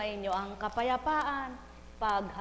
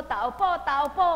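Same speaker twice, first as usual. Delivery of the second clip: mock calling at a door; 4 semitones higher, 10 dB louder than usual. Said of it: "sabi mo".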